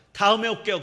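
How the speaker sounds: loud, curt goodbye; retold angry exit. Pastor retelling how he left the hospital, loud and curt. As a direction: storm out with a clipped parting line. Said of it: "제가 막 화가 나갖고 그냥 나왔어요".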